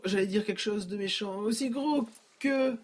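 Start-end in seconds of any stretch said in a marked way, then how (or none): none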